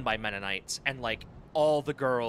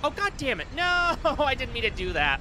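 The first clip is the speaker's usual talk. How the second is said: startled outburst; 10 semitones higher, 3 dB louder than usual. Real, not acted. Misclick reaction.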